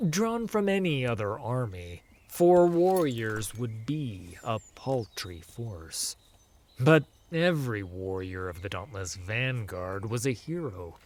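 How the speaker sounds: plain reading voice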